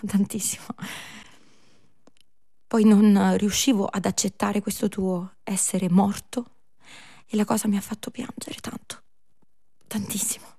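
hushed tearful tenderness; tender whisper. Acted. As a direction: let the words fade into a whisper. Maria tenderly tells of grief.

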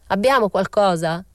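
put-on old cabinetmaker calling loudly into the dark; shouted question. Old craftsman shouts to his son in the workshop, loud call. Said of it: "gridò il vecchio".

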